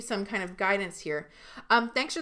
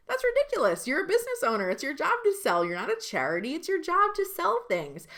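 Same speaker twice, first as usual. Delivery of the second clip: high-pitched, incredulous insistence; emphatic dismissal; 8 semitones higher than usual. Real, not acted. Coach telling business owners not to feel guilty selling, emphatically. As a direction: push back hard, pitch leaping up.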